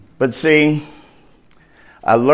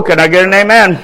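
normal talking voice

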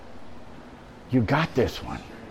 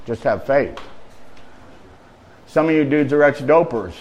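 ordinary talk, nothing marked

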